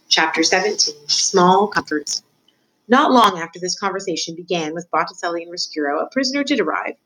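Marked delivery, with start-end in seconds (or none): none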